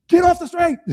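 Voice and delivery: high, loud yell out a window. Retold or imitated. Mayor to people still out in the storm, yelling. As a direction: shout it as a warning. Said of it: "and yell".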